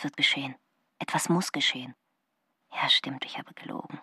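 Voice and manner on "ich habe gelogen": a hushed breath of a whisper, admitting it; whispered confession. Acted. Margarita admitting to herself that she lied, whispered. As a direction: whisper it as if afraid to be overheard.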